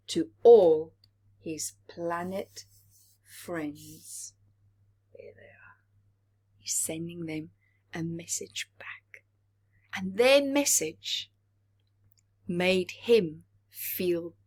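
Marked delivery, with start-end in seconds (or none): 5.1-9.3 put-on soft whispered question to young listeners; hushed aside. A narrator's reading. Storyteller whispering to the children, hushed aside. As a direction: lean in and whisper the secret.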